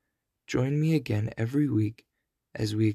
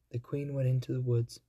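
plain reading voice

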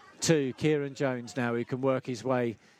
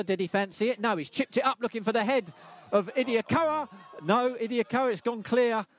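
raised, eager play-by-play pitch; excited commentary; 6 semitones higher than usual. Real, not acted. Commentator following a corner into the box, excited. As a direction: lift the pitch as the chance builds. Live call of a goal chance.

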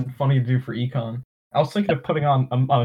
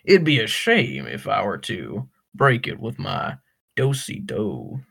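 mock Southern; 3 semitones lower than usual. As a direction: play a courtly Southerner, unhurried and low. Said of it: "is a southern accent".

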